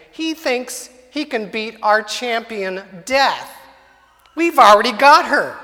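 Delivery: lightly voiced gleeful devil bragging; gleeful Satan. Satan boasts to his servant demons, mildly gleeful gloating. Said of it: "gleefully saying".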